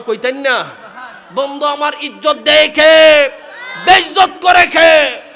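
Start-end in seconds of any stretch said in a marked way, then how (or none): 1.3-5.2 preaching at full force. Acted. Preaching climax, shouted.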